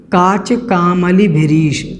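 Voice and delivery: slow, with कामली said clearly as kaməli; careful recitation. Shown as a demonstration. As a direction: spell out काच कामली भिरीश slowly. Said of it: "तीसरी पंक्ति है उसमें आया है".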